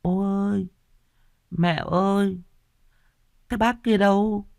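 put-on matter-of-fact toddler, pitched very high; lisping toddler. A narrator's reading. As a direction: ask innocently like a babbling tot. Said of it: "nói tỉnh bơ trong cái giọng ngọng líu lô".

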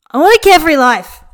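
put-on high, loud drunken bellow. A narrator's reading. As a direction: bellow it to the whole river. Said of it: "he bellowed".

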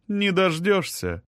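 high, slow, lilting retort; taunting queen. A narrator's reading. Queen answers her rival, taunting. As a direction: toss the refusal back in a high voice.

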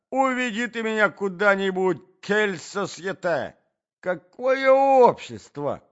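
high, loud, agitated lament; appalled gentleman wailing in protest; distraught prince. A narrator's reading. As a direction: beg loudly to be taken away.

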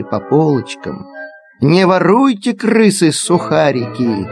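plain reading voice

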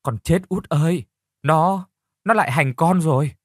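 a lightly acted sob of pain; khóc nức nở. A narrator's reading. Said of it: "khóc nức nở". (khóc than nhẹ, giọng cao)

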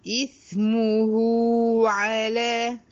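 إثمه with the hu held two counts; two-count sila. Shown as a demonstration. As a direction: stretch the hu of إثمه for two beats. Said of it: "هنا برضو حركتين". (إثمه بصلة صغرى حركتين)

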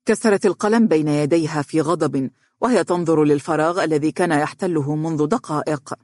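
plain reading voice

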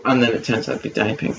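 ordinary talk

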